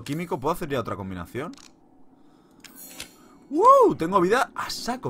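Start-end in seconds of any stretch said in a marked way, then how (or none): 3.5-5.1 high loud delighted wow; excited. Real, not acted. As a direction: gasp with delight. Sees health restored.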